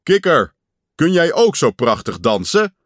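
loud call to the audience; boastful call. A narrator's reading. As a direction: raise your voice and show off. Pat boasts to Frog from stage.

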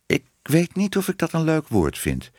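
high-pitched, mildly doubtful voice; hesitant. A narrator's reading. Woman unsure about the new word, hesitant. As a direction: voice a polite doubt. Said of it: "zei Minoes weifelend".

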